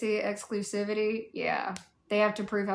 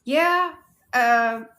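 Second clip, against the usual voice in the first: loud; 3 semitones higher, 10 dB louder than usual. Real, not acted. Sudden loud outburst.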